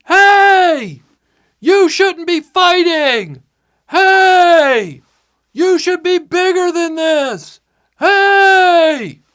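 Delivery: high, drawn-out distant holler; full-voiced mock yell to fighters far below; yelling into wind. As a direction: holler slowly across a windy valley. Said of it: "starts yelling into the wind".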